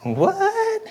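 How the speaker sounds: very high, squeaky mock "what?"; feigned disbelief. Husband caught not feeding the cats, mock disbelief. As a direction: play innocent in exaggerated falsetto surprise. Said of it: "I always respond by going".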